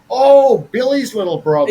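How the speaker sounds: loud, bright realization; oh, it clicks, she remembers him; sudden recognition. Retold or imitated. Sudden recognition in retold quote.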